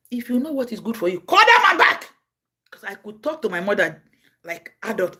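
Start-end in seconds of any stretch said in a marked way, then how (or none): 0.1-2.1 stern warning. Retold or imitated.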